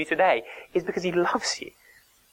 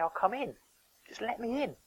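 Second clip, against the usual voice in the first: pleading; 8 semitones higher, 9 dB quieter than usual. Pretend. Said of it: "I'm shouting outside the window".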